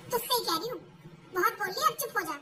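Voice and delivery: ordinary talk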